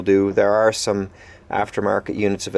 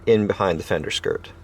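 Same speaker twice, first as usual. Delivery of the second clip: ordinary delivery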